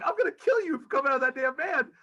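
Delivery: high, heated threat; angry threat. Retold or imitated. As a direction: threaten him, fired up. Retold threat from the van.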